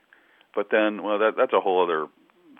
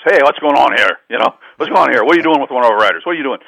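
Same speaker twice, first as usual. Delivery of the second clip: louder, higher manager barging in; retold loud demand; 4 semitones higher, 9 dB louder, faster than usual. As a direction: burst in loudly demanding what's up. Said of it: "He goes".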